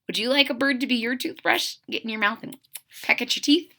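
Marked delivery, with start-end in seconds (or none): none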